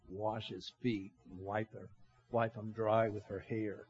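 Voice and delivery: low, hushed musing to oneself; private inner thought. Retold or imitated. Preacher voicing what is in Simon's mind, low and quiet. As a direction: keep it low and soft, like thinking aloud.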